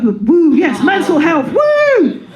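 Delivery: high, loud mock whoop; sarcastic cheer. Real, not acted. Comic sarcastically cheering for mental health, high mock whoop. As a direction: whoop it like a fake party cheer. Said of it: "mock cheer".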